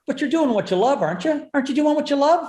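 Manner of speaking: a hint of Seinfeld's sing-song disbelief; Seinfeld impression. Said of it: "I love when Seinfeld says to him".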